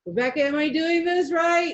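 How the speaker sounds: high, loud, worried asking; anxious student. Retold or imitated. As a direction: play the nervous knitter begging for a check. Voicing her anxious past self.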